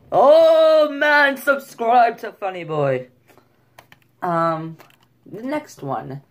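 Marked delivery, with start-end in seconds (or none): 0.1-3.1 loud, high, drawn-out Jamaican imitation; mock Jamaican accent. Shown as a demonstration. Vlogger hamming up his plug, exuberant mock Jamaican. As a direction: belt out a hammed-up Jamaican accent.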